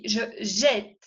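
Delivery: jette with an open E, jaw dropping; open E demo. Shown as a demonstration. Teacher modelling the open E sound. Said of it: "Ese sonido es E, la mandíbula cae".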